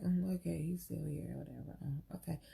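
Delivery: quiet, dismissive mutter dropping to a whisper; sulky brush-off under her breath; muttered attitude. Retold or imitated. A woman with an unwanted guest, muttering with attitude. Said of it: "we got a little attitude".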